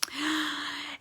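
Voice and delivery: sharp suspense gasp. Real, not acted. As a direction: gasp in surprise. Suspense before bear wakes.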